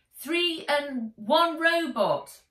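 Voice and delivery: loud, slow shout upward; shouted reply. A narrator's reading. Nat answering the policeman below, loud shout. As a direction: shout it back across the water. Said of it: "she shouted back".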